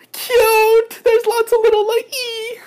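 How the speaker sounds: tiny, very high, loud gushing; delighted squeal. Real, not acted. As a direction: squeal at something adorable. Delighted by little gift items.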